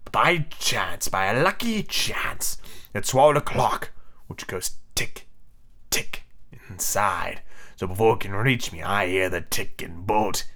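low, slow, husky captain; drawn-out, uneasy villain; haunted Hook. Acted. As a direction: confide the dread slowly and low. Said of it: "there was a quiver in his voice".

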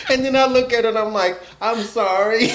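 fast, high, laughing apology; laughing about rambling at his patient partner. Real, not acted. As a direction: giggle through the confession.

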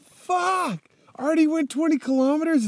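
very high, fast, dismayed cry; shocked outburst. Retold or imitated. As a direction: squeal it out in disbelief. Frustrated at the distance.

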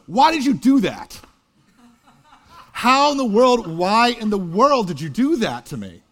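put-on high, loud, anguished cry of a hurt teenager; a son yelling at his father after a near crash. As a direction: shout it out, upset and betrayed. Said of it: "when his son yells at him".

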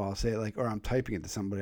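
usual speaking voice

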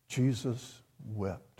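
Jesus wept, quiet and slow; hushed repeat. Shown as a demonstration. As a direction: drop to a hushed, reverent echo. Repeats the verse for weight.

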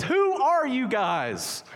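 high, slow, loud amazed question; amazed server. Retold or imitated. Server chasing the generous diners, stunned and loud. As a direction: gasp it out like a stunned waitress.

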